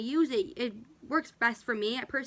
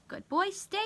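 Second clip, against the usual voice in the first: high, bright praise for the dog; 9 semitones higher than usual. Real, not acted. Praising the dog during training.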